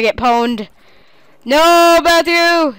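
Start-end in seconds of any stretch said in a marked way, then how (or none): 1.4-2.8 high loud cry of dismay; anguished cry. Real, not acted. Player watching a favourite unit fall, high anguished cry.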